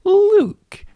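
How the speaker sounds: very high, loud squeal of his own name; squeaky self-intro. Acted. Host introduces himself to listeners in a squeaky high squeal. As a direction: shriek the name like a cartoon.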